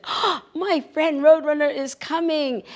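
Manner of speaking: high, loud burst of joy; excited. Acted. Coyote spotting Roadrunner's dust, excited and loud. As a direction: cry out with delight at her return.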